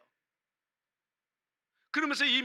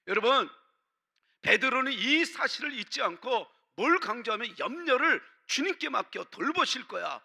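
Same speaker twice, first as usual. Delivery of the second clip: ordinary delivery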